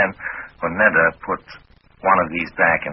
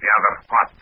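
put-on Russian accent, pitched up; Russian-accented question, slightly raised voice; best Russian accent; 4 semitones higher, 3 dB louder than usual. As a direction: answer in a feigned Russian accent. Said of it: "in my best Russian accent".

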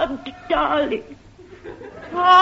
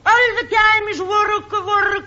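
hammed-up Italian mamma complaining about work; Italian wife wailing; 5 semitones higher than usual. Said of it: "I played Missus Guglielmo Marconi".

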